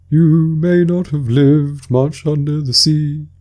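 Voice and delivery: high, slow, sobbing turtle voice; a weepy sea creature struggling through tears; tearful Mock Turtle. Mock Turtle speaking to Alice, high and tearful.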